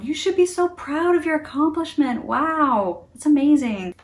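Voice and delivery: impressed and delighted congratulations; gushing praise. Acted. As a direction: gush over someone's achievement. Voicing praise given to someone.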